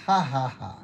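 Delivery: declared ha-ha-ha; laughing at illness. Shown as a demonstration. Preacher laughing at cancer by faith, deliberate laugh. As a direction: laugh on purpose at the disease. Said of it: "Tawanan ninyo".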